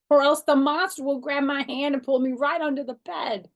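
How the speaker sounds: put-on high, loud, scared child; real upset. A narrator's reading. Girl fears the monster under her bed, high and frightened. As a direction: plead in a frightened rush. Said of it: "I said, real upset".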